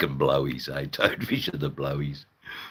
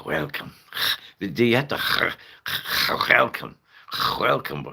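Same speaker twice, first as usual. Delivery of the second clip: exaggerated guttural Dutch; 3 semitones higher than usual. Shown as a demonstration. Streamer attempting Dutch pronunciation, overdone throaty Dutch accent. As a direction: welcome with a throaty Dutch rasp at the start. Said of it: "You gotta roll the R's".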